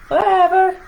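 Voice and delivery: high, loud, cheeky shrug of a line; sassy dismissal. Acted. The sun shrugging off its size, sassy. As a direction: toss it off like a diva who doesn't care.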